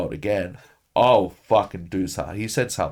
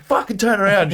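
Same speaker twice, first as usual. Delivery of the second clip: much higher, louder angry order; angry demand; 11 semitones higher, 6 dB louder than usual. Retold or imitated. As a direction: bark it at the driver. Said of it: "I'm like".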